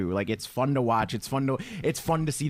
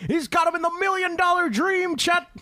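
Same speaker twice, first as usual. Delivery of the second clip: much higher, loud commentator call; excited announcer; 3 dB louder than usual. Retold or imitated. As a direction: call the hold like ringside commentary. Announcer impression.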